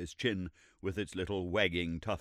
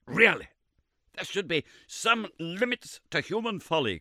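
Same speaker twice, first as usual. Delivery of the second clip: sharp higher outburst of scorn; positive fury; 11 semitones higher, 6 dB louder than usual. Acted. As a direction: snap at the layman's folly. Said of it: "cried Summerlee in a positive fury".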